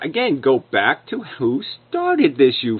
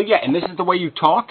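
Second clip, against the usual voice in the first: ordinary delivery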